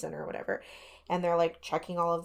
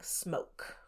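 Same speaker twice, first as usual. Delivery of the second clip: soft, nearly voiceless quote; hushed word from a ghost recording; imitated ghost voice; 9 dB quieter than usual. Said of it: "they get a voice very clearly saying".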